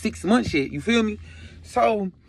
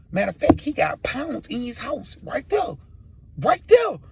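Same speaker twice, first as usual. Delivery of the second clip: high, slow, insistent pointing-out; earnest squeaky persuasion, acted; eager snitching; 5 semitones higher, slower than usual. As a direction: sell him out, pitch climbing. Informs on dealer to save himself.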